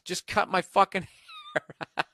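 cracking up on the last words; breaking into laughter. Real, not acted. Host reading customer's retort to hairdresser, breaking into laughter. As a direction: start the indignant line, then lose it laughing.

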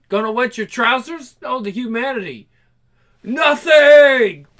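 hammed-up furious punk rant; ranting punk singer. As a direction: tear into the TV audience.

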